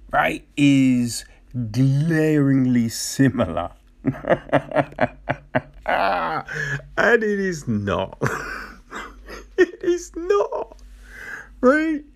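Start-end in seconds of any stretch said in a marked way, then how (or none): 4.0-5.6 long incredulous laugh. Real, not acted. Laughs at the claim. 5.9-12.0 high, drawn-out denial through laughter; laughing denial. Real, not acted. Denies claim while laughing.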